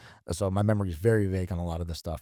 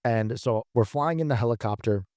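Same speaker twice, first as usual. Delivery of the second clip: ordinary delivery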